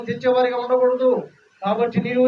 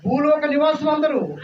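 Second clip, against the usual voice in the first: loud, high preacher's emphasis, line 8 slower; forceful preaching; 5 semitones higher, 4 dB louder than usual. Real, not acted. Preacher urging the congregation, raised forceful voice. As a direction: proclaim it with force. Preacher stressing fear of God.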